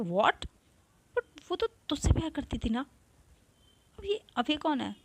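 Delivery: high, loud shocked disbelief. Acted. Avantika hears Neha's news, shocked and high. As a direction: gasp it out in disbelief. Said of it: "Avantika और भी shocked हो जाती है".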